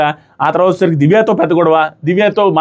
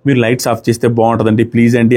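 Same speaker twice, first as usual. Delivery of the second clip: lower, gentle asking voice; polite request; 8 semitones lower than usual. Shown as a demonstration. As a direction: ask it nicely, like a favour. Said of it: "అన్నట్టుగా అడిగితే బాగుంటుంది".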